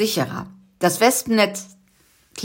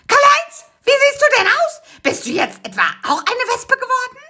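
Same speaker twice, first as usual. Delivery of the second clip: put-on much higher, loud old-woman shriek; shrill astonished aunt; 3 dB louder than usual. The aunt sees Karl-Heinz dressed as a wasp, shrill and high. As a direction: squawk in shocked disbelief.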